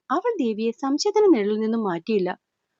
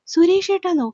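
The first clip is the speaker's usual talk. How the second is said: higher, louder stunned question; shocked disbelief; 7 semitones higher, 4 dB louder than usual. A narrator's reading. Suresh stunned that she calls him Suresh-etta, shocked disbelief. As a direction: blurt it out, eyes popping in disbelief. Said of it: "കേട്ടതും കണ്ണു തള്ളിപ്പോയി".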